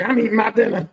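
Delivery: loud, irritated holler; acted dad shout; annoyed dad yelling. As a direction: bellow it like an interrupted, irritated father. Dad yelling during his meal.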